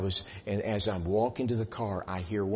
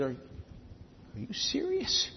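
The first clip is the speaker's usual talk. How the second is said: high, soft, slow astonished question; drawn-out gasp of disbelief; stunned disbelief; 12 semitones higher, 7 dB quieter, much slower than usual. Retold or imitated. Preacher stunned by her praise, soft drawn-out disbelief.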